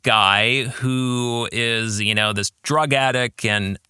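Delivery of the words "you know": ordinary delivery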